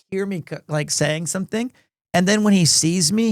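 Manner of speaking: ordinary talk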